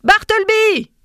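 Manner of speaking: loud, high-pitched shouted call; raising the voice. A narrator's reading. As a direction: shout the name to summon him. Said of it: "dis-je en élevant le ton".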